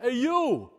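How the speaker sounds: a hint of New Jersey in "Hey, you!"; lightly voiced New Jersey hail; New Jersey callout. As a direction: call out, Jersey style, lighter now. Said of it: "This isn't Greek".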